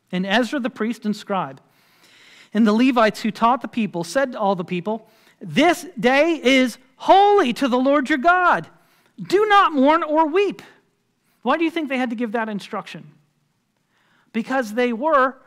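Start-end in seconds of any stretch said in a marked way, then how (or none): 5.4-10.6 high, loud scriptural proclamation; proclaiming. Acted. Nehemiah and priests to all the people, proclaiming. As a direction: declare it to a whole crowd.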